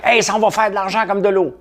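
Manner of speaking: high-pitched mock investor excitement; hyped-up investor. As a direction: voice the gullible crowd's hype, pitch jumping way up. Voicing hyped-up first-wave investors.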